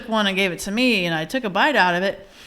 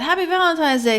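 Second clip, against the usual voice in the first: high cheery greeting; 8 semitones higher than usual. Retold or imitated. Mom greets son Logan on Valentine's morning, high and cheery. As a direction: own Valentine's greeting sung out higher. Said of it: "I said".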